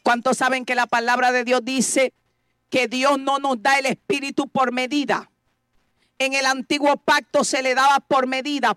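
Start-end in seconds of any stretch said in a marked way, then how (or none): none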